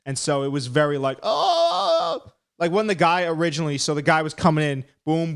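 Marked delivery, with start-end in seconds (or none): 1.2-2.3 a high yelp whose voice gives out; cracked voice yelp. Retold or imitated. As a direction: yelp "Oh!" as if the voice fails. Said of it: "My voice was failing by that point".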